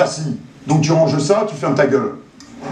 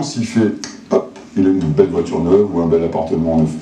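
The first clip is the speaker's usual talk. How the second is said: usual speaking voice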